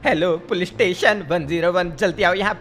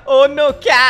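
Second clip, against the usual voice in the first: loud, higher gasp of disbelief; shocked; 7 semitones higher, 8 dB louder than usual. Acted. Friend hearing the girlfriend was taken, loud shock. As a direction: blurt it out in shock.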